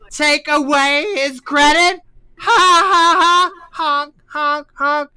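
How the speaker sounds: high, loud, cackling clown voice; silly clown. Acted. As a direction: ham up a desperate clown. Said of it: "and then he's like".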